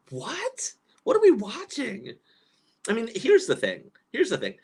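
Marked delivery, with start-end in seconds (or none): 0.1-2.1 much higher, incredulous what; shocked disbelief. Retold or imitated. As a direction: squeak it in stunned confusion. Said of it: "I was just like".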